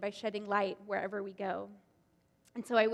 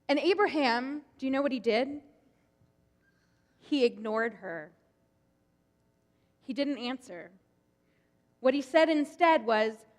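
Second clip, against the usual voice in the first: ordinary delivery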